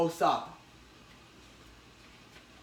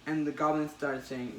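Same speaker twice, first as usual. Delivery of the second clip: ordinary delivery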